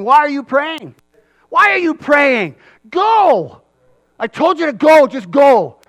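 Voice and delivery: high, loud insistent urging; exasperated command. Retold or imitated. God telling the people to stop praying and go, loudly. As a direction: push them out the door.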